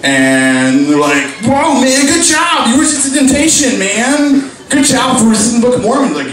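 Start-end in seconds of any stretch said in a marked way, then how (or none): 1.4-6.1 high-pitched, hammed-up congratulations. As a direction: gush over a friend's willpower. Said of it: "Mormons, when they talk in the lockers".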